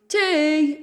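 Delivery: high, loud market cry; shrieking seller. Acted. Tea seller shrieking to the crowd. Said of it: "another seller shrieked into the crowd".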